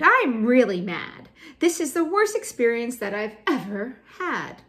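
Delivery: loud, emphatic mad tantrum; fuming. Acted. Teacher voices a girl having a fit, loud acted fuming. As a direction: play it fuming, hammed up for a poem. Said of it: "She fumed".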